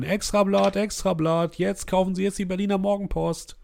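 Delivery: hoch und laut ausrufender Zeitungsjunge; Zeitungsjunge ruft. Acted. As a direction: schrei die Schlagzeilen auf der Straße aus. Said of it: "Zeitungsjungen rufen". (lauter, hoher Zeitungsjungen-Ausruf)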